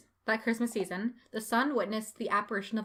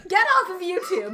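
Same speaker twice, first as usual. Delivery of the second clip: laughing; 7 semitones higher, 6 dB louder than usual. Real, not acted. Laughing at background music.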